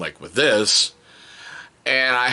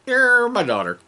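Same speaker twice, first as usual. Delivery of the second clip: high, louder mock outrage; high loud you're my daughter; high exasperated cry; 4 dB louder than usual. Real, not acted. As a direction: cry it out in disbelief. Exclaimed dismay.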